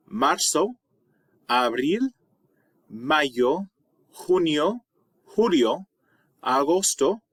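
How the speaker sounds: each month name said slowly and clearly, with a rise on each; pronunciation demo. Shown as a demonstration. Teacher pronouncing the months for students to repeat. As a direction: read the Spanish months as a careful listing for pronunciation. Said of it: "so you can hear how they're pronounced".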